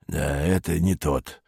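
put-on slow, low, soft elderly voice; old man. A narrator's reading. Old man in queue sets it straight, slow and low. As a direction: correct them slowly as an old man. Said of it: "сказал справедливый старик".

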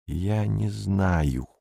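slow, soft, sour reply; bilious. A narrator's reading. Perets answers Beatrice's question, biliously. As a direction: draw it out with quiet bile. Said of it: "желчно сказал Перец".

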